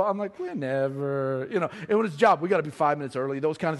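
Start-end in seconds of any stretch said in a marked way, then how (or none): 0.4-1.5 low, drawn-out casual reply; slow, couldn't-care-less answer; laid-back indifference. Retold or imitated. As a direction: stretch it out lazily, low in pitch. Acts his laid-back answer to wife.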